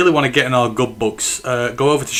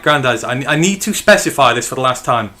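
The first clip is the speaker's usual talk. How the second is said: normal talking voice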